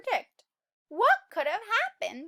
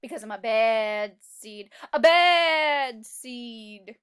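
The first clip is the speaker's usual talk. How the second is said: bad seed voice; 4 semitones lower, 9 dB louder, slower than usual. Teacher reading the bad seed's boast to kids, slow and drawn out. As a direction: stretch out the last bad seed slowly.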